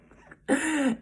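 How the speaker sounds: sudden high loud laugh that breaks out; burst of laughter. Real, not acted. Girl laughs mid-story about her crying, a sudden burst.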